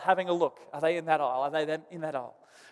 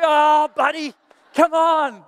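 higher, louder mock-pleading protest; half-laughing plea to a tattling kid; exasperated plea; 8 semitones higher, 6 dB louder, slower than usual. Dad begging his son who told on him, exasperated plea.

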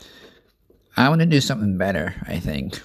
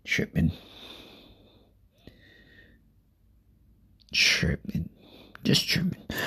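whispering; 4 semitones lower, 8 dB quieter than usual. Real, not acted. Quiet aside while eating.

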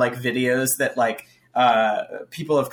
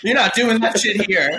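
higher, laughing telling-off; mock parental outrage; 8 semitones higher, 4 dB louder than usual. Co-host joining in as the meme parent, mock parental outrage. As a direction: lay down the law, half laughing.

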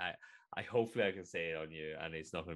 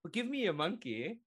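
pitched-up deadpan bus driver; high voice landing the joke; high cheeky punchline; 10 semitones higher than usual. Acted. Bus driver delivers the punchline, high and cheeky. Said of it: "He goes".

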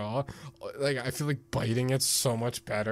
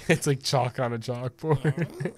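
laughing; 4 semitones higher, 8 dB louder than usual. Real, not acted. Laughing while reacting to video.

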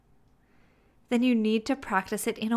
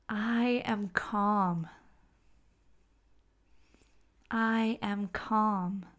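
slow, soft, relaxed; soothing calm voice; truly calm; 5 dB quieter, much slower than usual. Shown as a demonstration. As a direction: breathe it out calmly. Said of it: "you can hear the difference".

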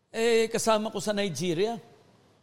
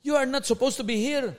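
higher mock scolding; retold angry scolding; 6 semitones higher than usual. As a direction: imitate the agent's anger, half acted. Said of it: "Sabi niya".

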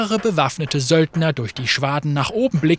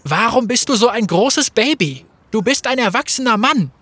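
higher, louder taunt; exasperated call; 6 semitones higher, 4 dB louder than usual. Acted. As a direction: scold him like a whiny child. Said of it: "rief Fate".

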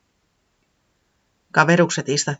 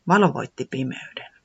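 ordinary delivery, nothing marked

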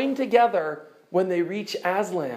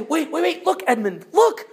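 excited call; 7 semitones higher than usual. Acted. A child calling Edmund to see the view, excited. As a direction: point and shout for the others to see. Children spot new Narnia.

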